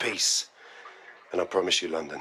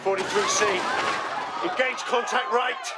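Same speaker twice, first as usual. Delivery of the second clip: combat shouts; 13 semitones higher than usual. Acted. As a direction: bark the orders over gunfire. Soldiers under attack on news footage.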